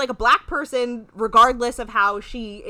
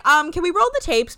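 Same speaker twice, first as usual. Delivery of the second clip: pitched up high and louder; mock indignant demand; 8 semitones higher, 5 dB louder than usual. Acting out her demand for the tapes, high mock indignation. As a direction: put on a loud, high challenge.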